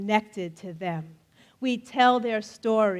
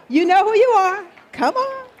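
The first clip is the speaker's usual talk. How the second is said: much higher and louder, urging; eager coaxing; 8 semitones higher, 7 dB louder than usual. Real, not acted. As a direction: call out to shy volunteers. Calling volunteers to stage.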